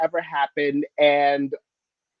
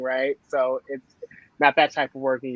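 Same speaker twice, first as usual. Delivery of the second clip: ordinary talk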